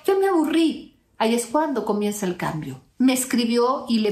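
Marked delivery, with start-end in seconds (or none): 0.1-1.0 mock-bored sigh, pitched high; bored dismissive quote. Imitating the ex who no longer sees her as special, high and bored. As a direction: toss it off like you're over him. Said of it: "ya no lo veía acá y dijo".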